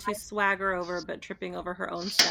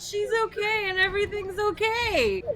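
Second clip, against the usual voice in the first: pitched-up tipsy pleading; high drunk reassurance; 12 semitones higher than usual. Acted. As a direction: sing out that all is fine. Drunk Violet reassuring Alphys.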